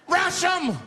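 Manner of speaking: high-pitched rallying shout; screamed out. Acted. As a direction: yell a frantic call to charge. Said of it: "He screamed out".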